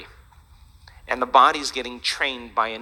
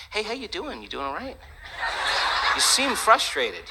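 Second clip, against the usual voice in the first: teasing mock concern; 6 semitones higher than usual. Golfer needling friends who duffed a shot, teasing mock concern. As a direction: feign concern to dig at them, voice kept down. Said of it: "love to dig them a little bit".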